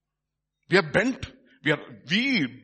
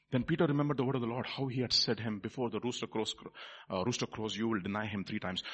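low, soft storytelling voice; hushed scripture retelling; 7 semitones lower, 8 dB quieter than usual. A narrator's reading. Preacher recounting Peter's denial to congregation, hushed.

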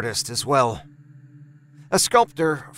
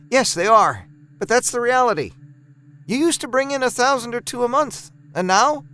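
higher-pitched, exasperated wife pressing the point; wife Laura; 9 semitones higher than usual. A narrator's reading. Wife pushing back on her husband's muse, higher and exasperated. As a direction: voice Laura higher, frustrated about the bills.

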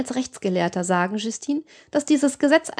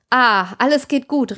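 slow, louder exclamation; drawn-out, raised call; exclaiming; 3 semitones higher, 5 dB louder than usual. A narrator's reading. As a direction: call out slowly that all is well. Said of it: "rief die Dubois aus".

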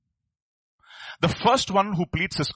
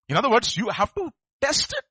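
normal talking voice

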